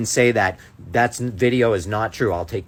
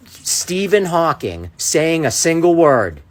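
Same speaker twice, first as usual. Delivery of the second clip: usual speaking voice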